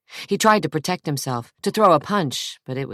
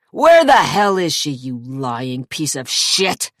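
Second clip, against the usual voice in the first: furious shout; 6 dB louder than usual. A narrator's reading. Zach to his lying brother, furious. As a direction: scream it, then spit the insult.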